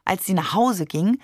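ordinary delivery

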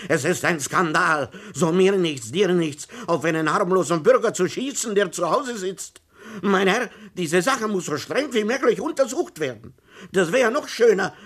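high, loud outraged tirade; indignant ranting. A narrator's reading. Shot-at official demands the police act, outraged high shouting. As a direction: rant in shrill indignation at the scandal. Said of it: "ereiferte sich Herr Thomsen".

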